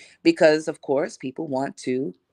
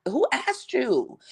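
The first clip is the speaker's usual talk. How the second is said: high, slow sassy put-down; girl, who asked you; indignant clapback; 10 semitones higher, slower than usual. Retold or imitated. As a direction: shut her down slowly with attitude. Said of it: "I'm looking at her like".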